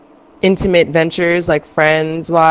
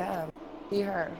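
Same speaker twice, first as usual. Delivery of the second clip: far from mic; 3 semitones higher, 18 dB quieter than usual. Real, not acted. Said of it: "That's a little better".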